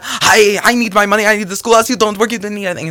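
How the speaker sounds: high, loud marital row; yelling in argument. Acted. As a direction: shout back, high-pitched and indignant. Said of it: "Zaniel yelled out".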